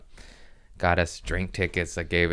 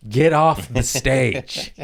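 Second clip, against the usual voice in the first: high call, stretched at the end; club enforcer; 7 semitones higher, 4 dB louder than usual. Acted. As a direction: order the star off the stage. Club throwing famous comic off stage.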